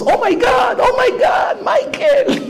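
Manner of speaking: shrieking fangirl mock scream, very high; screaming fans. Passengers squealing at the pop star. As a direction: squeal like starstruck fans on the plane.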